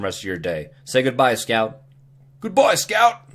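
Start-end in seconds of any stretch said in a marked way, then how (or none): 2.4-3.2 high-pitched, shaky attempt at Brooklyn; bad Brooklyn accent. Scout signs off, bad Brooklyn accent. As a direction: put on a high, badly faked Brooklyn voice. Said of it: "I can't do a Brooklyn accent".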